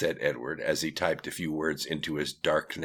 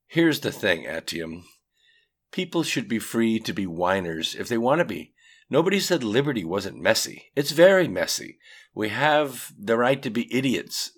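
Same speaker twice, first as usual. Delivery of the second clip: Edward; 6 semitones higher, 6 dB louder than usual. A narrator's reading. Edward argues back.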